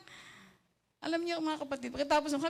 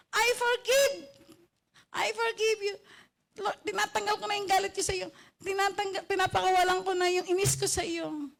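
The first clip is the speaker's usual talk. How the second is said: high, pleading prayer to the Lord; earnest retold prayer; 5 semitones higher than usual. Preacher reenacting her prayer to the Lord, high and pleading. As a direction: pray it out, letting go of anger.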